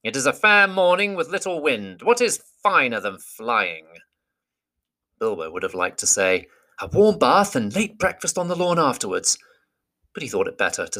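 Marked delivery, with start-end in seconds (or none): 0.0-3.9 proud lofty eagle, higher; a great bird talking down kindly. Acted. Eagle speaks to Bilbo. 6.8-9.4 wistful hobbit, higher; a longing little hobbit; Bilbo voice. Acted. Bilbo's unspoken wish.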